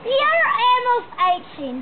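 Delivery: put-on high, drawn-out Australian accent; slow mock-Australian mum.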